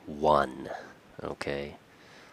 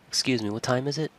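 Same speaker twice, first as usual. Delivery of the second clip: rising, puzzled question; confused, polite; 7 semitones higher, much faster than usual. Shown as a demonstration. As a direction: sound unsure and polite, pitch lifting at the end. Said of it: "You see how you kind of sound confused there".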